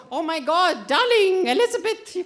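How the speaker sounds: high, loud, hammed-up English accent; mock English accent. English diner to darling Elizabeth at supper, mock English accent. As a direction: put on a theatrical English voice, climbing in pitch. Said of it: "this is my English accent".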